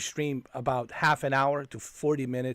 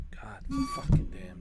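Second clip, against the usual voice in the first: drawn-out disgusted curse at the game; exasperated curse; 4 dB louder, slower than usual. Real, not acted. Streamer cursing at a broken game, drawn out and exasperated. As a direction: stretch the curse out in pure exasperation.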